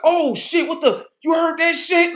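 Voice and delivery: high, loud alarmed cat chatter; startled cats. Retold or imitated. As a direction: voice cats spooked by a bang. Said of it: "they be like".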